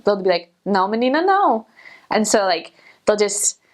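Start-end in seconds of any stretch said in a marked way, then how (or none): 0.7-1.7 imitating the locals, with the final no swooping up high; a lilting, sing-song Brazilian Portuguese "No, menina, no"; sing-songy Northeast lilt. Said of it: "this sing-songy thing".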